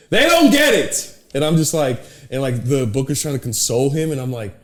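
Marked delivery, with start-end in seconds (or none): 0.1-1.1 much higher, louder, slower indignant whine; mock angry rant. Brody pissed backstage, higher slow indignant rant. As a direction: whine it like a wounded comic.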